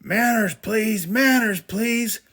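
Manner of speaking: high, loud fatherly shout. Acted. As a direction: call order over the chaos.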